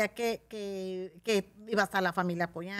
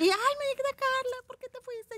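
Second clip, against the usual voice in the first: much higher and slow, whiny fan; pleading fan; slower than usual. Retold or imitated. Fan asking why she left, high whiny plea. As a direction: whine it like a disappointed fan.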